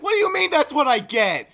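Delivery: fast, high, loud retort; reenacted yell of offended protest; indignant. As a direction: fire it back in outraged disbelief. Retold argument with friends.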